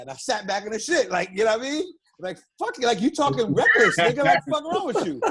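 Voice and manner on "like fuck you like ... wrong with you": retold anger. Retold argument, voice rising.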